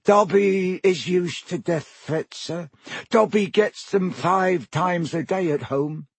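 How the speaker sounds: high, slow and meek; a feeble elf's smile in the voice; weak high elf voice. Acted. Elf answering Harry's threat, weak and high. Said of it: "Dobby smiled weakly".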